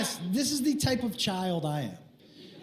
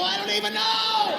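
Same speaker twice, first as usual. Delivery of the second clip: flustered wail; 10 semitones higher than usual. Retold or imitated. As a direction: wail it helplessly. Flustered husband's climax, acted.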